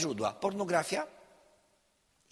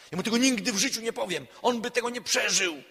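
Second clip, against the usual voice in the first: higher, louder and fast, an indignant cry; a wife's fast, indignant exclamation, higher and louder; exclaimed outburst; 9 semitones higher, 8 dB louder than usual. Retold or imitated. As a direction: burst out, shocked and refusing. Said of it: "wykrzyknęła".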